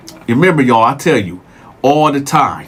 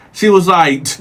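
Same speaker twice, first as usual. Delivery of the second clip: ordinary talk, nothing marked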